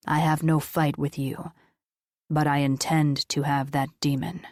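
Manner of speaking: solemn deep voice of an angel. Acted. Angel states his claim.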